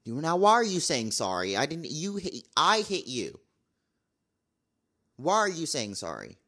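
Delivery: baffled coworker calling out a needless apology; exasperated retold question. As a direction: throw the question back in disbelief. Retold confrontation at work.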